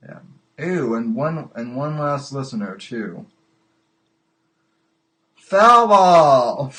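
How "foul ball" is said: loud, raised mock umpire cry; big, drawn-out sports shout; shouted foul-ball call. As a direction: holler it across the ballpark. Host calls out a foul ball.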